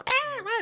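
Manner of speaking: high-pitched mock squeal of a pig; imitated pig squeal. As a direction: squeal like a pig being carried off. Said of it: "the pig's like".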